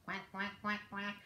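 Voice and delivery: soft low quacks, a touch of a duck; mother duck quacking. Acted. Mother duck calling her ducklings, soft low quacks. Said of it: "Mother duck said".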